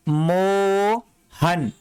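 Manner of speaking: Mohan stretched very slowly, syllable by syllable; drawn out. Shown as a demonstration. Teacher stretching Mohan slowly so students hear each sound. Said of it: "यहां पे आ की sound आ रहा है तो मो हो गया".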